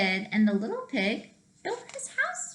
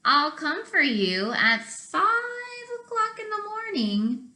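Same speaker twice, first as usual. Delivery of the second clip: sly wolf; 8 semitones higher than usual. Acted. Wolf promises to fetch the pig, high and sly. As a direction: plot it slyly.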